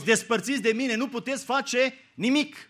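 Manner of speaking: emphatic quote. Acted. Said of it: "Uite ce spune Hristos".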